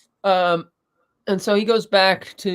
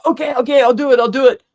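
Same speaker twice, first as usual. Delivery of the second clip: panicked pleading; 7 semitones higher, 6 dB louder, faster than usual. Retold or imitated. Councilman gives in under pliers.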